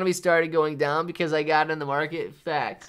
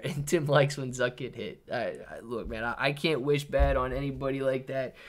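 normal talking voice

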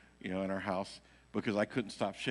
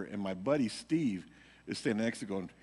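ordinary talk, nothing marked

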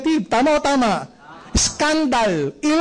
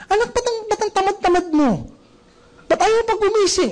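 higher-pitched exasperated mum voice; annoyed parent scolding; 9 semitones higher than usual. Retold or imitated. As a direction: scold the noisy kid, fed up. Parent nagging lazy child.

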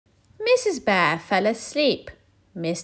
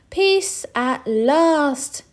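very slow, higher relief; relieved sigh; 8 semitones higher, 5 dB louder, much slower than usual. Acted. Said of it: "he said to himself".

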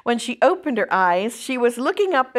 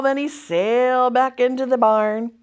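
ordinary delivery

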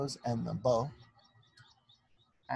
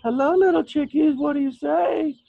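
high, loud sing-song cooing; 12 semitones higher, 14 dB louder than usual. Real, not acted. Mother cooing to baby chicks over the video, high and bright. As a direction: coo at the chicks like babies.

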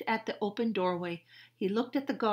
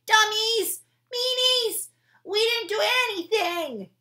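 put-on much higher, louder child's yell of protest; shouting; 12 dB louder than usual. Annie shouting at the guards who arrested her. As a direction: scream your innocence at the guards. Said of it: "Jack heard Annie shouting at the guards".

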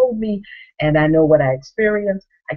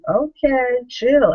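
put-on teen attitude; 7 semitones higher, much slower than usual. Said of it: "And she had attitude".

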